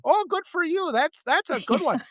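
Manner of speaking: put-on high, approving Wisconsin-accented cop; northern Wisconsin cop. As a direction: imitate a folksy, pleased Wisconsin cop. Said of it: "by a cop in northern Wisconsin".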